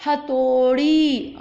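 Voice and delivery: हथौड़ी slow with a long औ; slow model word. Shown as a demonstration. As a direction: say हथौड़ी slowly for them to copy. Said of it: "आप ध्यान से सुने".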